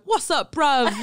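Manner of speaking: loud, high mock British greeting; bad British accent. Seattle crew just landed in the UK, bad British accent. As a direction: put on a hammed-up British accent. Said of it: "everyone starts doing a British accent".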